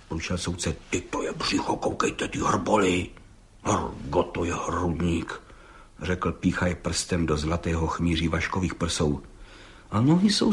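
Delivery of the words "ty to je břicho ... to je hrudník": an unvoiced whisper of admiration; hushed awe. A narrator's reading. Judge whispering to the gendarme in admiration. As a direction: breathe it out, impressed.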